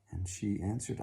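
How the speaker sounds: ordinary delivery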